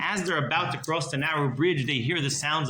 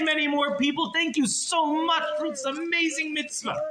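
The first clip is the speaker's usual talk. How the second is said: much higher, gushing blessing; effusive praise; 11 semitones higher than usual. Acted. Rebbe gushing thanks to the miser for a penny, much higher. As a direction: heap on delighted thanks.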